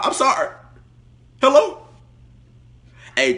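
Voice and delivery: normal talking voice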